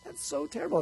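high, gasping mock dismay; mock horrified dog lover. Audience member gasping at a loathed dog. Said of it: "you're like".

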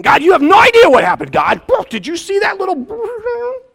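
mock outrage spilling out to God; worked-up complaint. Retelling how he ranted to God after getting angry, mock outrage.

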